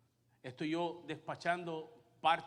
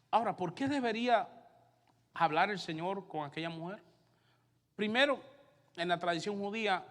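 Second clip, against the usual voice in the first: loud, higher preaching voice; fiery preaching; 4 semitones higher, 6 dB louder than usual. Acted. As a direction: drive each point home to the congregation. Preaching at full force.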